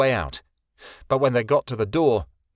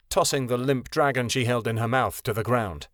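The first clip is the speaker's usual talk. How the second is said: ordinary talk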